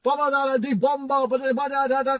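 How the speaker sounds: high-pitched gibberish rant; rattling nonsense syllables, high and unbroken; mock ranting. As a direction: imitate a driver's nonstop babble, pitched high. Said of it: "so he starts ranting off".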